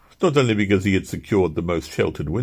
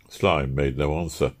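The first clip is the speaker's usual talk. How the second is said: ordinary delivery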